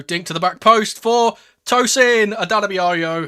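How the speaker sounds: rising excited goal call; building excitement. Real, not acted. Fan's live call, goal excitement.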